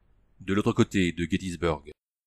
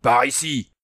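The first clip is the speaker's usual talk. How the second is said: curt; 4 semitones higher, 4 dB louder, slower than usual. A narrator's reading. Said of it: "répondit sèchement Hans".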